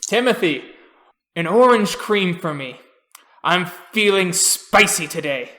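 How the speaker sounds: loud seadog placing an order; old crusty sailor. Acted. Old sailor ordering from Timothy, loud and jaunty. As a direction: call it across the diner as an old salt. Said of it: "old, crusty sailor going".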